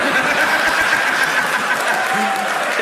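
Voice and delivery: a high real laugh. Real, not acted. Comic laughing at the Taurus answer. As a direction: burst out laughing.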